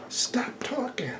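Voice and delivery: high, soft slow scold; hushing the dog. Real, not acted. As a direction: quietly hush the dog, up high.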